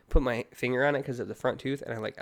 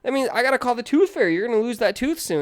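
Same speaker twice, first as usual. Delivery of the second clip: higher and louder, bursting with excitement; thrilled dad; 11 semitones higher, 6 dB louder than usual. Retold or imitated. Dad telling his son the tooth fairy is coming, thrilled. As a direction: sell the tooth fairy news to the kid.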